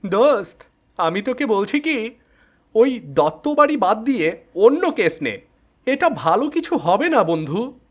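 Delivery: high, pleading friend voice; worried friend. Acted. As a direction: beg your buddy to drop the case.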